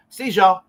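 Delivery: "See y'all" run together as "see-zho"; picked-up slurring. Retold or imitated. Man catching himself copying the slang, "See y'all" slurred to "see-zho". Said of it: "found myself talking a little later going".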